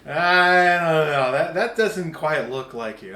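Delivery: loud, slow, drawn-out doubt; doubtful friend. Retold or imitated. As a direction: hesitate loudly over the shoes. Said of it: "your friend goes".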